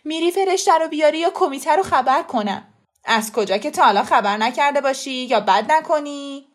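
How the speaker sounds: loud, high-pitched angry threat; very upset. A narrator's reading. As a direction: threaten loudly, voice full of distress. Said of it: "با صدایی که از شدت ناراحتی کلفت شده بود". (تهدید بلند از شدت ناراحتی)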